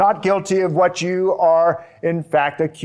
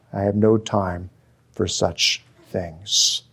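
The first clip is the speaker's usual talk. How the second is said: repeated lower with scorn; scornful; 6 semitones lower than usual. Shown as a demonstration. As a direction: let the contempt sink into the words. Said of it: "another scornful statement".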